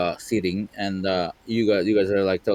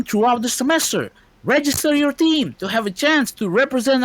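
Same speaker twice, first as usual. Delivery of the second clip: high, loud stadium announcer; 14 semitones higher, 4 dB louder than usual. Acted. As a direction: proclaim it to a packed arena. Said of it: "I will read it as the M C".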